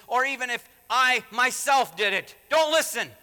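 high, forceful warning; Paul dead serious. Acted. Preacher voices Paul warning the Galatians, loud and dead serious. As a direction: build to a loud, emphatic command. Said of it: "Don't think Paul's not serious about this".

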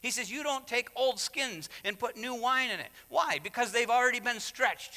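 high, urgent sermon delivery; impassioned preaching. Real, not acted. Preacher explains the parable of old skins, high-pitched and impassioned.

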